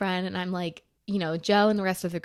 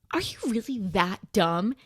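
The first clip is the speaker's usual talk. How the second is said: slow, mildly exasperated retold question; incredulous scolding; 4 dB louder than usual. Friends to Joe in retelling, incredulous scolding. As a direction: space it out in disbelief.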